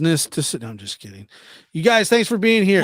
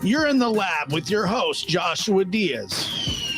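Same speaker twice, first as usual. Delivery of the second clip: announcer voice; 5 semitones higher, 5 dB quieter than usual. Acted. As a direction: show host intro read. Show intro announcement.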